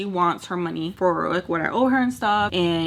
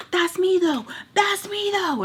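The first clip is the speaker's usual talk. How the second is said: much higher, whiny mock voice; high whiny mimicry; mocking her excuse; 12 semitones higher than usual.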